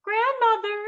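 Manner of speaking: put-on wolf putting on a high-pitched little girl voice. A narrator's reading. Wolf fooling Grandmother at her door, fake little girl voice. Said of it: "in his best little girl voice".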